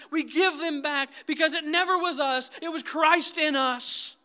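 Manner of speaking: impassioned sermon climax, loud and high; full-force preaching. Acted. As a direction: preach it hard, rising to the rafters.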